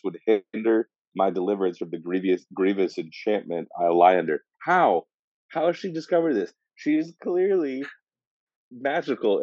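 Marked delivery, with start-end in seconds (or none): 4.6-9.4 high, disbelieving questions; incredulous. Real, not acted. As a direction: ask it in mock disbelief. Incredulous rhetorical question about sorceress.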